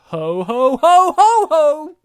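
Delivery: loud jolly Santa laugh, climbing high; booming mock Santa laughter. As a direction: belly laugh like Father Christmas.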